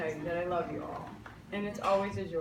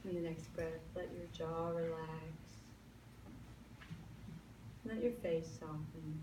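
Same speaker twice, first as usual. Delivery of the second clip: calm meditation voice with long pauses; guided meditation; 13 dB quieter than usual. Acted. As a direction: lead them gently into stillness.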